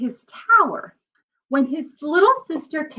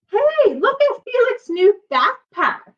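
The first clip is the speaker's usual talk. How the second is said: much higher mocking schoolkid voice; teasing kid; 11 semitones higher, 4 dB louder than usual. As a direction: jeer at his backpack like a bully. Kids tease Felix's backpack.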